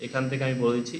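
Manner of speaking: ordinary talk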